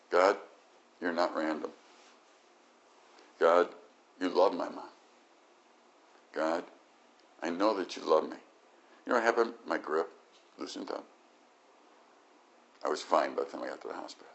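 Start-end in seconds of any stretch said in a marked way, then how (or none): 0.1-8.4 quiet calming prayer. Retold or imitated. Calming himself with truths.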